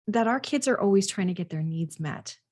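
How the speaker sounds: usual speaking voice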